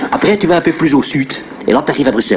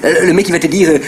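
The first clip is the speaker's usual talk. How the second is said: ordinary delivery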